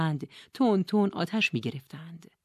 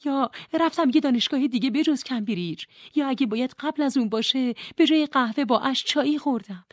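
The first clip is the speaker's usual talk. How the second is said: put-on higher, urgent young woman's plea; pleading young heroine, higher voice; Nora pleading; 7 semitones higher, 3 dB louder than usual. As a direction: beg her to send you back. Nora pleads with Mrs Elm.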